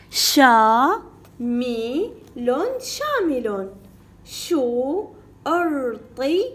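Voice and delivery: "شامل" stretched, split into slow syllables. Shown as a demonstration. Teacher reading by syllables for pupils, slow. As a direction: spell it out slowly in syllables. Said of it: "بالطريقة المقطعية".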